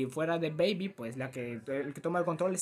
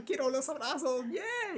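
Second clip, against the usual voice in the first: put-on high, slow sugary little-girl voice; typical cute girl; 8 semitones higher, slower than usual. Mocking the cute girl character in a high sugary voice. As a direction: play the cutesy girl begging for hugs, much higher. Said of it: "la típica niña".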